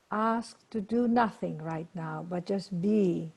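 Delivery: unhurried meditation voice with long pauses; guided meditation. Acted. Teacher leading a group to breathe and observe, guided meditation. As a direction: guide them into stillness, letting phrase ends fade.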